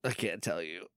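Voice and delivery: very low, soft mumbled refusal; imitated secretive mumble; mumbling. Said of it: "mumbling about something".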